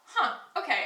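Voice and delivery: high, loud, laughing wow of amazement; stunned and half laughing; amazed, laughing. Retold or imitated. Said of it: "I was like".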